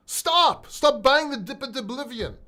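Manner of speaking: loud, high-pitched single-word order; sharp stop. Real, not acted. Ordering viewers to quit buying the dip, loud. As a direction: cut them off hard.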